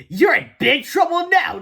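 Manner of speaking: high, slow gloating taunt; chuckling teacher. Acted. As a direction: chuckle with mean delight. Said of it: "chuckled Miss Anthrop".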